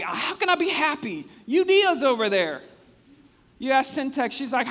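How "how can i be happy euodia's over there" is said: high, loud offended complaint; indignant churchwoman. Retold or imitated. Preacher voicing Euodia in a quarrel, high offended complaint. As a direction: sputter it like a hurt church member.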